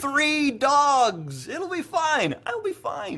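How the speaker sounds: high, nervous insistence; nervous bravado. Acted. Insisting he'll test the suit.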